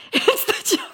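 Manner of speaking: cracking up, breathless high laughter through the words; laughing. Real, not acted. Co-host repeating the client joke, laughing. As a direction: squeeze it out through helpless laughter.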